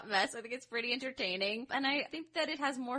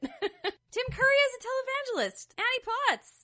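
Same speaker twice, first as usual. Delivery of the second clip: laughing; 6 dB louder than usual. Real, not acted.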